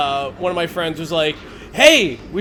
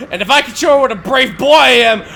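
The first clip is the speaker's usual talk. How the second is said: eager scheming; 4 semitones higher, 7 dB louder than usual. Retold or imitated. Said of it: "I was like".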